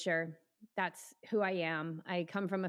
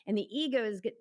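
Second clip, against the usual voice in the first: ordinary delivery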